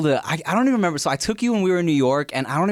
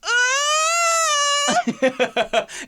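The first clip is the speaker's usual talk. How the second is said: high laughing shriek. Real, not acted. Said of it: "laughing hard at story".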